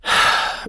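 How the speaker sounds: a long unvoiced sigh out; heavy sigh. A narrator's reading. Sam alone by the lake, heavy sigh. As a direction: let the air go, weary. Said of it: "She sighed heavily".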